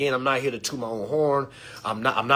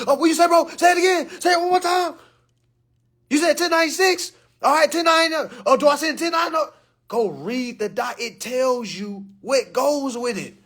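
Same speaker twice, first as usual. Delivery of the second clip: mocking eager follower; 11 semitones higher than usual. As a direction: ham up a frantic follower.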